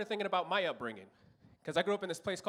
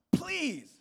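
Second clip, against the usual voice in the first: high, stretched whine; hammed-up pleading; desperate please; 10 semitones higher, 3 dB louder than usual. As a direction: beg with a rising squeal. Desperate begging word.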